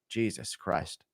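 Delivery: usual speaking voice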